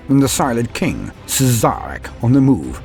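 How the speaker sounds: ordinary delivery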